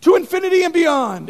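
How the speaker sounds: preacher mock-quoting Buzz Lightyear's catchphrase. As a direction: belt it like the toy spaceman, laughing. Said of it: "if I was like".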